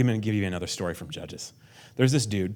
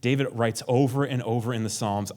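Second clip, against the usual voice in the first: ordinary delivery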